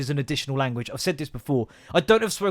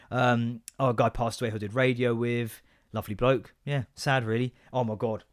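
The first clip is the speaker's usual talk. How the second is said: ordinary talk, nothing marked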